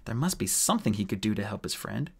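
usual speaking voice

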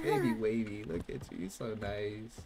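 hushed tender cooing; cooing whisper. Real, not acted. Cooing at the baby.

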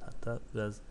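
ordinary talk

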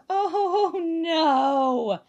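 oh no through a giggle; laughing. Real, not acted. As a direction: laugh it out, amused. Reader laughing at the picture.

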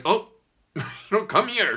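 friend's loud high call to the lion. Retold or imitated. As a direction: call an animal back to you. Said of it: "he was like".

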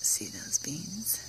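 slow hushed whisper. Real, not acted. Gardener pointing out beans to viewers, whispering slowly. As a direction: breathe it out slowly.

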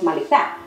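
"maleta" stressed on the last syllable, TA; wrong stress, maleTÁ. Shown as a demonstration. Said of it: "no decimos maleta".

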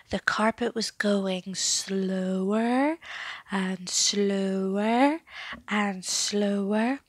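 hushed and slowing, drawn out; whispering the carpet to a stop. A narrator's reading. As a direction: let the words sink and slow down.

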